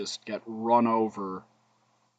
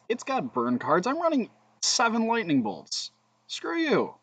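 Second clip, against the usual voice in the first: mock angry outburst; 12 semitones higher, 6 dB louder than usual. Real, not acted. Frustrated outburst at opponent's burn.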